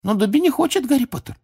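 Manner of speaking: very high elf squeak; high-pitched, objecting elf; squeaking Dobby. A narrator's reading. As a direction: squeak your protest like a little house-elf. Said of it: "снова запищал он".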